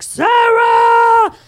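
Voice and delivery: high, loud drawn-out call; mock anguished shout. Father rushing outside after his daughter, shouting. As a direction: holler the name as if far away.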